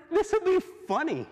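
giddy owner savoring a joke; high, playful voice of a mischievous boss; gleeful owner. Acted. Preacher voicing the gleeful owner.

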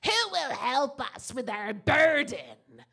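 slow, loud strange little man's voice. Acted. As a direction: drag it out, loud and odd. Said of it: "he has a very strange voice besides".

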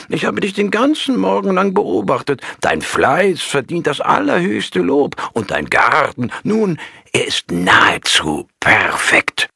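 put-on high, playful old monk praising slyly; a sly old master heaping praise; old monk's voice. A narrator's reading. Alter Mönch lobt verschmitzt. (hohe, verschmitzte Stimme eines alten Mönchs)

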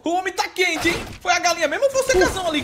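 loud, high-pitched disbelief; astonished outburst. Real, not acted. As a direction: cry out in amazement at the play. Espanto do narrador com o lance. (espanto gritado, voz lá em cima)

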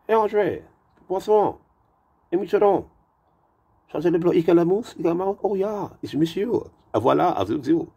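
high-pitched put-on French chatter; mock French waiter. Restaurant staff calling to each other in French, high mock-French chatter.

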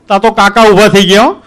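usual speaking voice

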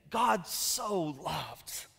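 low, soft and very slow; reverent hush. Real, not acted. Pastor savoring the verse to his congregation, reverent and slow. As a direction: let the verse land gently. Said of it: "pastor quotes verse reverently".

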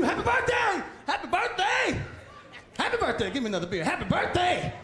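high, rowdy birthday whoops; a tipsy guest hollering happy birthday; drunk party cheer. Retold or imitated.

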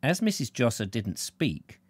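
plain reading voice

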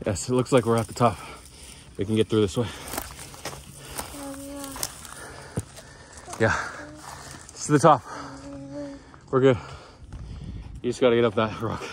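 winded after the climb; breathing hard between words. Real, not acted. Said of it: "I'm winded".

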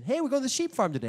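sing-song announcement from the mother-in-law; chirpy mother-in-law. Retold or imitated. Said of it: "her mother comes in".

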